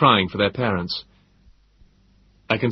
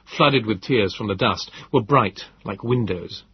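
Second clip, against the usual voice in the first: usual speaking voice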